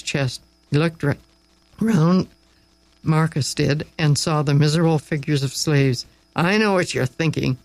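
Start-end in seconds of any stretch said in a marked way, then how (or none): none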